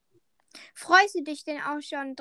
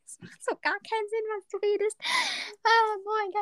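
mock exasperated; 8 semitones higher than usual. Teasing friend, mock-exasperated.

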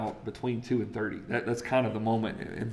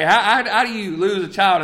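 louder disbelief; 6 semitones higher, 7 dB louder than usual. Shown as a demonstration. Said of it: "repeats the question louder".

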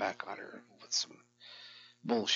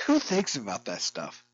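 usual speaking voice

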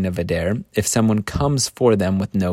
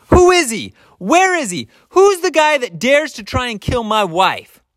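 enraged king, voice pitched way up; outraged demand; 13 semitones higher than usual. Acted. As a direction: demand the culprit in a high, furious voice. Enraged king demands the culprit, acted.

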